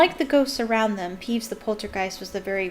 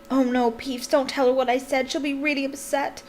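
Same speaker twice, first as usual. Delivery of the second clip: higher, urgent, half-whispered plea; frantic whisper; 5 semitones higher than usual. A narrator's reading. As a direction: plead in a hushed panic. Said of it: "Hermione whispered frantically".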